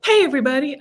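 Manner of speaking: high, bright-eyed hello burst; mock the too-eager opener; over-excited greeting. Shown as a demonstration. Guest mocking an over-excited podcast greeting. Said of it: "let me dial down the excitement".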